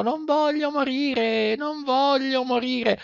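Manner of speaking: high, slow puppet wail; frightened boy puppet wailing; crying out in fear. A narrator's reading. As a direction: plead for your life in a high wooden voice.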